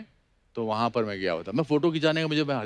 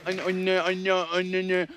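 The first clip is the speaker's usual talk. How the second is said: laughing; 4 semitones higher than usual. Real, not acted. Struggling to say 89, laughing.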